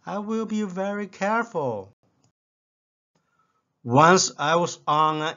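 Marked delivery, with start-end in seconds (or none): 0.0-1.9 high, pleased and grinning. Retold or imitated. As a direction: say it with a contented grin. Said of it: "grinned with contented smile and said".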